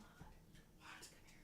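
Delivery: unvoiced hushed call; whisper. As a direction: whisper it like a cat with a canary. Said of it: "I'm like".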